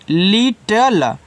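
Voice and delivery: Little stretched out very slowly; slow demonstration. Shown as a demonstration. Teacher models the word for beginners, little said very slowly. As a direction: draw out both syllables of little, lit-tal. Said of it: "ठीक है, ऐसे little".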